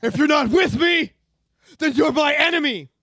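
dramatic shouted movie line; hammed-up Star Wars villain shout; Anakin line. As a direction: bellow it like a fallen Jedi. Star Wars quote reenactment.

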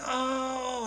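high, thin mock wail; screaming and crying. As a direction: imitate a hysterical woman wailing. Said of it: "She's screaming and crying".

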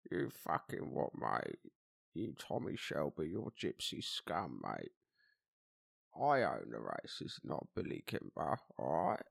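low, slow gangster impression; Alfie Solomons. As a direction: menace them slowly in a low gangster voice. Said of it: "I can do Alfie Solomons".

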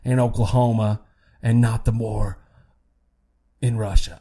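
lightly put-on politician's accent, measured with pauses; Keene's accent. Politician answering a question on Russia, in a lightly hammed-up accent. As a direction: try the senator's accent, pausing between phrases. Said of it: "a perfect accent".

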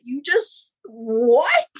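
rising, incredulous retold question; baffled disbelief. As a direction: break off into a stunned question. Baffled reaction to the gift.

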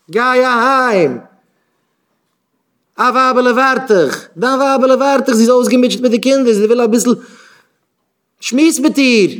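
high, loud pleading push; acted shout urging someone home; urgent exhortation. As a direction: call it out insistently, pitched right up.

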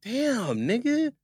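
high, fast offended protest. Real, not acted. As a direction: push back, insulted. Offended by the doubt.

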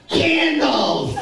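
high shouted cry; mock shout. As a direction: yell it across the store. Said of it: "and then I go".

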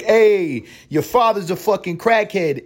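very high, loud imitated taunt; hammed-up high jeer; mock heckling shout. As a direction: holler it like a rude heckler. Mimics taunting heckler from Boston.

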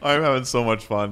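loud, high, gleeful cry of fun. Pretend. Playing Lane, the friend who skipped ahead, gleeful and loud. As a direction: belt it out like a kid on a sugar high.

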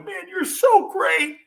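high, slow gushing praise; lightly hammed coach flattery; mock coach flattery. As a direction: gush empty praise like a coach. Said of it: "That's coach stuff".

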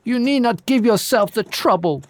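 high, hoarse and scornful. Acted. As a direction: rasp out the retort. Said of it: "hoarsely ejaculated the giantess".

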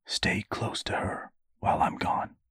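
hushed, urgent whisper; soft, breath-only fatherly order; whispered instruction. A narrator's reading. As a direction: whisper it to your son in secret. Father secretly instructing his son.

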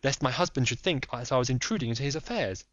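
lady pleading secrecy; Lady Hilda. Acted.

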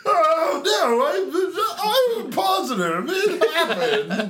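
high, laughing goody-good voice; chirpy innocent kid whine; fake wholesome. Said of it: "to make Ethan say".

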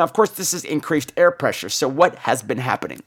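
normal talking voice

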